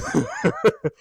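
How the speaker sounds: a real chuckle to oneself; laugh. Real, not acted. Reviewer amused after announcing spoilers, short laugh.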